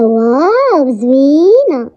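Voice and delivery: long drawn-out, swooping admiration; gushing, slow "wow"; admiring wow. Acted. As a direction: stretch each word out in delight. Acted admiration of the dress.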